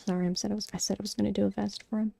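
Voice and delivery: ordinary delivery, nothing marked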